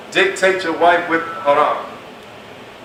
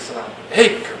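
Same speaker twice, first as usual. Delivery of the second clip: lower, soft sultry salam; flirty sweetened greeting; 7 semitones lower, 4 dB quieter than usual. Shown as a demonstration. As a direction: purr it to tempt the listener. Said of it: "She said".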